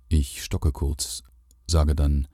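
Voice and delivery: ordinary delivery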